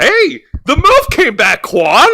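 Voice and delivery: high, loud, gleeful call; excited teasing. Real, not acted. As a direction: cheer him on, teasing.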